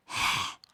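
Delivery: loud, forceful open-mouthed exhale; lion's breath. Shown as a demonstration. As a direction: breathe out audibly like a lion. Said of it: "is the lion's breath".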